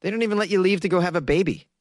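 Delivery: normal talking voice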